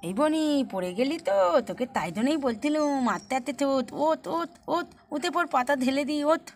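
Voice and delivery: put-on little child fussing over a fallen playmate; small child's chiding voice. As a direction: play a toddler urging a friend up.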